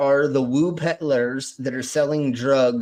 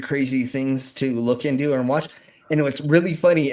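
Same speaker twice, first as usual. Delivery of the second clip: ordinary delivery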